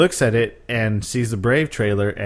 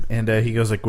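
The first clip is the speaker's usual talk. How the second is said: normal talking voice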